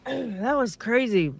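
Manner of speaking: coughing, drink down the wrong pipe; choking on drink. Real, not acted. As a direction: talk while recovering from choking. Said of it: "My drink went totally down the wrong pipe".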